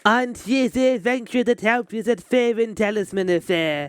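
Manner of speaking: put-on a light French accent on a game character. Streamer voicing a game character in French accent. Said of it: "the French accent again for no reason".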